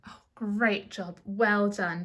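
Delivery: ordinary delivery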